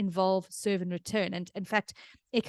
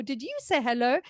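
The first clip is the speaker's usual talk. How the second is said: put-on higher, lilting question to a baby; affectionate baby talk; 5 semitones higher than usual. Parent responding to baby's attempt at hello, affectionate baby talk. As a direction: answer a baby's babble with encouraging interest. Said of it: "instead of having somebody go".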